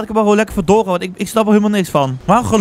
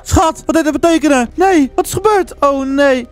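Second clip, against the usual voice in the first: raised, higher-pitched worried voice; acted alarm; 7 semitones higher, slower than usual. Boyfriend calls to girlfriend after her scream, alarmed. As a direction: call out in mock panic.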